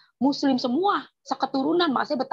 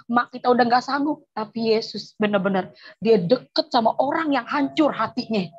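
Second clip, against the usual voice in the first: ordinary talk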